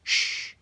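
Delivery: sharp unvoiced shush; hissed shush. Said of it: "hissed Professor McGonagall".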